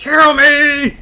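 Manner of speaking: very loud, high-pitched bad impression; Metro. Streamer retrying Metro's weird accent, high hammed-up yell. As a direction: belt out a hammed-up whiny demand. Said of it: "I'm really bad with impressions".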